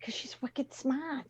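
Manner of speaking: put-on a light touch of a Boston accent, said quietly. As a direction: toss it off in a mild Boston accent. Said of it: "I can do my Bostonian accent".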